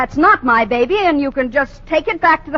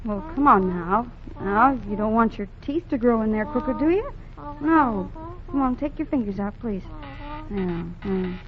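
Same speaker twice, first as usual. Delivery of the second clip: put-on coaxing baby; 2 semitones lower, 8 dB quieter than usual. Soothes the baby.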